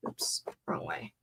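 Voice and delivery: soft whisper to herself; whispered oops. Real, not acted. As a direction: breathe it out under your breath. Mutters to herself over a mistake.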